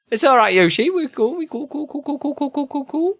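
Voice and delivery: squeaky-high rapid run of "cool"; playful reassurance. Pretend. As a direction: reassure them in a silly high voice.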